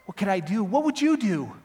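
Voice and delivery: desperate appeal. Acted. Defendant with his soul at stake, loud desperate appeal. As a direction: plead loudly, pitch rising on the second question.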